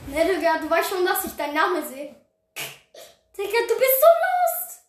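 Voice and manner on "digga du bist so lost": mocking. Real, not acted. Mocking a fleeing player.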